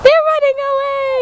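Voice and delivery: high, loud excited call; excited shout. Real, not acted. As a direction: call it out as they flee. Chasing birds.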